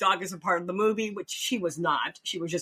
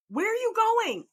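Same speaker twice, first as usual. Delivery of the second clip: panicked; 3 dB louder than usual. Retold or imitated. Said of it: "And I'm like".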